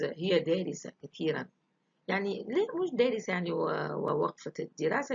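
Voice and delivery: ordinary talk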